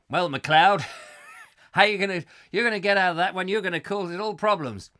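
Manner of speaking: loud, ranting TV police boss; ranger bloke. Acted. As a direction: bawl out MacLeod, half laughing. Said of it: "that short little ranger bloke".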